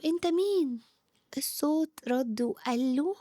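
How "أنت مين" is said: mildly startled, higher-pitched question; a touch of alarm, voice lifting. A narrator's reading. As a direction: ask as if lightly taken aback. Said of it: "إلياس اتخض وقال له".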